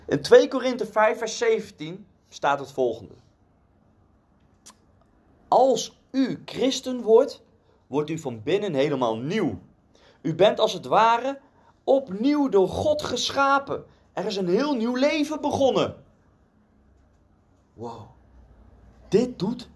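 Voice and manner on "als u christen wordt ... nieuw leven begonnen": high, emphatic proclaiming of a verse; scripture reading. Real, not acted. Preacher reading Corinthians to the congregation, high emphatic proclamation. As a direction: proclaim it from the pulpit, pitch raised. Reads a Bible verse aloud.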